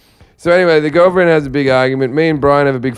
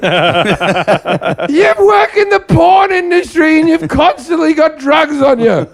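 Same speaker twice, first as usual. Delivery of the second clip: shouting; 13 semitones higher than usual. Retold or imitated. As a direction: yell the accusation, pitched high. Yelling at Brian in retelling.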